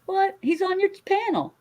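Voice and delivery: high shocked disbelief; surprised. Real, not acted. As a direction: blurt it out in shock. Shocked at AI claim.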